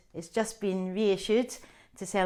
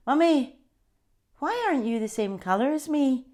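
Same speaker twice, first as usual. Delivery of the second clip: lightly voiced child; 7 semitones higher, 5 dB louder than usual. Child asking her mum.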